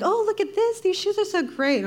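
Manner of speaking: gushing mom. Retold or imitated. Daughter mimics mom excited about shoes at the mall.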